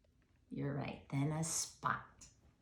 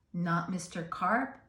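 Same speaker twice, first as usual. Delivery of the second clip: plain reading voice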